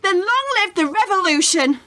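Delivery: rising mock-indignant cry; excited. Answering the communist charge with excited fervour. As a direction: fling it back with theatrical zeal.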